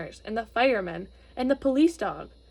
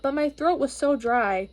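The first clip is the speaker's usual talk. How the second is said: ordinary delivery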